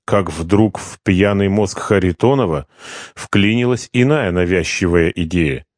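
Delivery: ordinary talk, nothing marked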